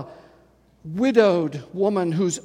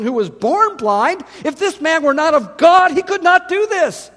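raised, rising preaching voice; fervent preaching; 5 semitones higher, 3 dB louder than usual. Acted. Preacher proclaiming the miracles to the congregation, fervent. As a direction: build the fire of a sermon.

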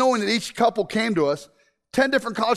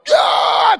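high, loud delighted yell; excited yell; 12 semitones higher, 7 dB louder than usual. Retold or imitated. Husband whooping as wife hugs him, loud excited yell. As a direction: whoop with joy at her hug. Husband reacting to wife's hug.

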